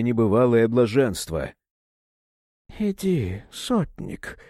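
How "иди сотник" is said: husky, hissing whisper; hoarse hissing voice. A narrator's reading. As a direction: breathe it out in a hoarse hiss. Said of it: "хрипловатым, каким-то шипящим голосом".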